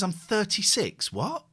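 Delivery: ordinary talk, nothing marked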